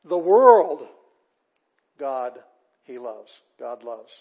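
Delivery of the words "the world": WORLD very loud, high and drawn out; fronted WORLD, swelling hugely; stress on WORLD. Shown as a demonstration. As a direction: boom out a long, emphatic world. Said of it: "That's fronted".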